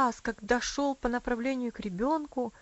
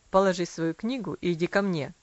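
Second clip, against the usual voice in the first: lower stern father; 4 semitones lower, 6 dB louder than usual. A narrator's reading. Father voiced in story.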